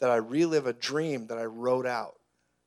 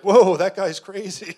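louder, higher, slow alarmed whoa; mock alarm; 6 semitones higher, 10 dB louder, slower than usual. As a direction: back away from the weird guy. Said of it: "He's like".

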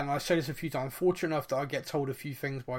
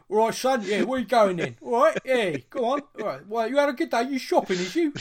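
put-on a loud, higher London cabbie accent; hammed-up East End cabbie chatting up his fare; London taxi driver accent; 8 semitones higher, 6 dB louder than usual. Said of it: "like an East End gangster".

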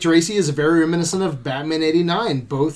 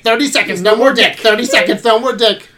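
higher and louder, chanting it; excited repeated quote; 5 semitones higher, 6 dB louder than usual. Retold or imitated. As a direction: keep hammering the catchphrase with glee.

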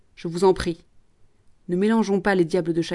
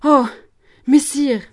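shocked cry, higher and louder; scandalised; 6 semitones higher, 7 dB louder, slower than usual. Acted. Jeanne shocked by the promoteur, scandalised cry. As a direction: gasp it out, outraged. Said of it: "Jeanne scandalisée".

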